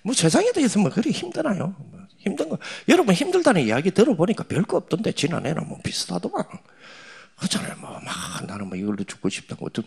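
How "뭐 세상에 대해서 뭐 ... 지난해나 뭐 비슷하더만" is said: much higher scoffing tone; dismissive mockery. Real, not acted. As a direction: brush off their troubles. Mocking youth complaints. (청년들의 고민을 높은 목소리로 비웃듯)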